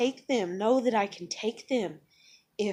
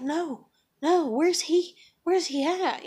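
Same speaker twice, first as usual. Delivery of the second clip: higher-pitched imitation of an upset child; retold crying plea; 7 semitones higher, 4 dB louder, slower than usual. Mother imitating her crying daughter, mild distressed plea. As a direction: insist like a child wanting an answer, mildly acted. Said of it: "she's crying and screaming and everything".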